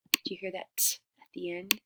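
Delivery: ordinary delivery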